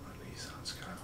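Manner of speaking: barely audible, fully whispered. Real, not acted. Friend slipping in a guess at the crashed car, whispered. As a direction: whisper it under your breath. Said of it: "that's why I whispered".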